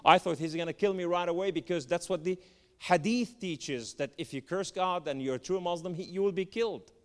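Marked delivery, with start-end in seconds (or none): none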